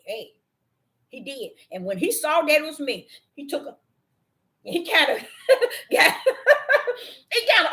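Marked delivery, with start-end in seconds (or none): 6.2-7.1 a high burst of laughing; high laugh at the dog's sudden change; laughter. Real, not acted.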